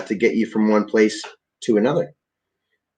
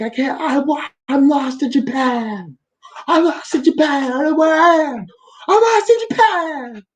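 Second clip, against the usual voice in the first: mock sobbing wail. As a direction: sob it out like a lost child. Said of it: "I'm like".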